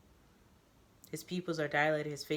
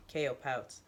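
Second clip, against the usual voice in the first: ordinary delivery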